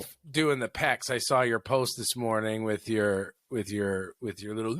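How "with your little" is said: normal talking voice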